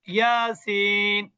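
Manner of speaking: ইয়াসিন drawn out slowly, each letter name stretched; recited letters. Shown as a demonstration. Teacher recites the disjoined letters for students, slowly drawn out. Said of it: "কিন্তু এটাকে কি বলা হয়?".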